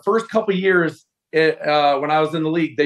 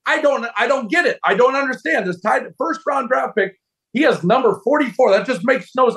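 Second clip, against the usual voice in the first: much higher, bewildered broadcaster voice ribbing a rookie; mocking John Madden; 9 semitones higher than usual. TV broadcaster ragging on the rookie's jersey number, mock-baffled high voice. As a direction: pitch it up like a baffled TV announcer poking fun. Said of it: "like, making fun".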